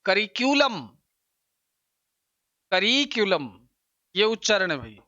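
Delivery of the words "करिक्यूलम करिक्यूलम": करिक्यूलम with the stress on the second syllable, रि; correct pronunciation. Shown as a demonstration. Teacher giving correct करिक्यूलम for the exam, clear demo. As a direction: say करिक्यूलम as kə-RI-kyu-ləm. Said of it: "उच्चारण होता है".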